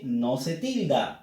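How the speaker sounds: normal talking voice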